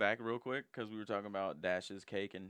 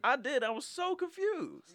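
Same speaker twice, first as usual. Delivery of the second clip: much higher, slow baffled voice; surprised; 3 dB louder, slower than usual. Retold or imitated. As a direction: let the confusion climb up high. Shocked recounting costume.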